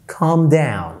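ordinary delivery

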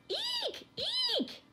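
very high mouse squeals; frightened squeak. A narrator's reading. Mice startled by the kitten, squeaking. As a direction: squeak like mice who spot a cat. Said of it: "squeaked the Kantas".

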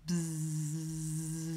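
soft steady buzz; bee buzz. A narrator's reading. Narrator imitating bees among the flowers. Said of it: "bees in the flowers".

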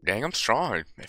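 usual speaking voice

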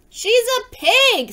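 high, loud, drawn-out protest; storybook tantrum cry; indignant outburst. A narrator's reading. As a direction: wail the objection slowly and high. Angry Eugenia contradicts the praise.

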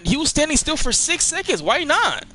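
high, incredulous pitch jump; can't believe what he's hearing; squeaky disbelief; exasperated. Real, not acted. Exasperated at teammate's choice.

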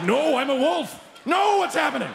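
high-pitched bewildered howl; hammed-up dog losing its will; protesting dog. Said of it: "The dog's like".

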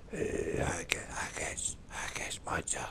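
breathy mocking whisper of an English player. Scottish gamer mocking an English player's whispering, hushed mock whisper. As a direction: whisper it like a creepy weirdo, mocking. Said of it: "Starts whispering to me".

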